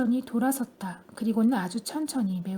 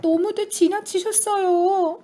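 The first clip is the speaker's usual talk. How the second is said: much higher, louder, slow cry; alarmed shout; 7 semitones higher, 6 dB louder, slower than usual. A narrator's reading. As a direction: shout in dismay. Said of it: "소리쳤다".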